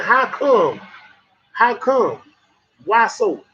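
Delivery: a light country-folk delivery of how come; country way of saying it. Shown as a demonstration. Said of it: "that's the country way to say it".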